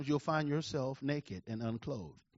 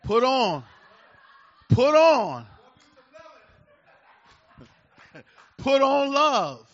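loud, higher, laughing through it; hammered-out slow cry; preaching shout; 9 semitones higher, 13 dB louder, slower than usual. Acted. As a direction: build to a joyful shout.

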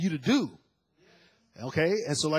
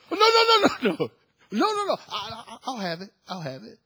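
panicked plea; 4 semitones higher, 7 dB louder than usual. Retold or imitated.

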